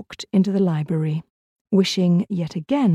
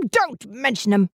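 malevolent screech; 3 semitones higher than usual. A narrator's reading. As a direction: spit it out like a spiteful old man. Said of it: "screeched Mister Elm malevolently".

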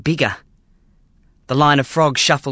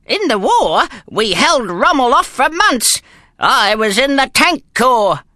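put-on proud old soldier; 10 semitones higher, 3 dB louder, slower than usual. Gramps boasting war memories.

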